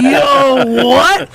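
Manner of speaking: high, incredulous mock reaction; shocked son. Acting son's shocked reaction.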